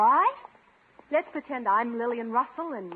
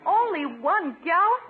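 dismayed disbelief; 8 semitones higher, 4 dB louder than usual. Acted. Paula dismayed at one gallon.

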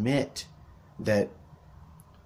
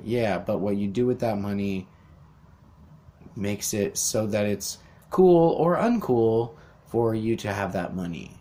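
ordinary delivery

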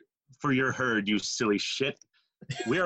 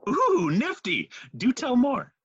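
put-on childlike joy; 12 semitones higher than usual. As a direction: squeal like a delighted child. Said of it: "change from anger to delightful, childlike joy".

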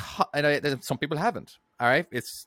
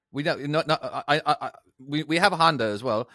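ordinary talk, nothing marked

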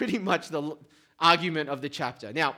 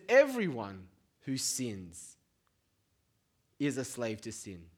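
slow scripture reading; 7 semitones lower, 5 dB quieter, slower than usual. Shown as a demonstration. Quoting verse slowly.

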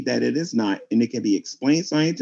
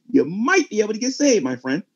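ordinary delivery

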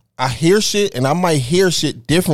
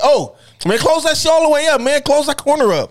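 high urgent order; mock panicked order; 11 semitones higher than usual. Imitating a friend ordering the fridge shut, high and urgent. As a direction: hurry him to close it. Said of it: "he was like".

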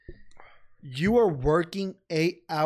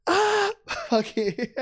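high drawn-out give-up; much slower than usual. Retold or imitated. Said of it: "I'm like".

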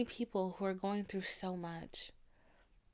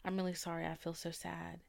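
softer, low-key sad murmur; mild sad apology; 4 semitones lower, 9 dB quieter than usual. Retold or imitated. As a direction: apologize quietly, a touch sad. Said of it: "I was like".

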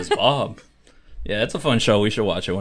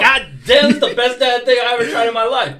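loud, high hammed-up Cooper voice; Agent Cooper impression; 13 semitones higher, 5 dB louder than usual. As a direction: gush over the coffee like Agent Cooper. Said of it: "he's like".

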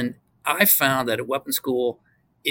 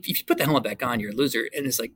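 normal talking voice

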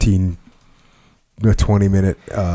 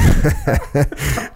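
laughing; 7 semitones higher than usual. Real, not acted. Laughs at own story.

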